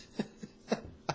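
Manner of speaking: airy wheeze of a laugh; breathy laugh. Real, not acted. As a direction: laugh out silently. Laughing at the funny line.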